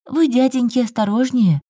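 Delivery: put-on high child voice, earnest warning; serious and concerned. A narrator's reading. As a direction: warn them earnestly like a little boy. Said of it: "серьёзно и озабоченно предупреждал ангелок". (серьёзно и озабоченно, детским голосом)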